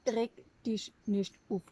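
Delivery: slow, attempted Hessian accent; Hessian dialect. Co-host trying out a calming phrase, Hessian dialect. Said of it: "Was, hessisch?".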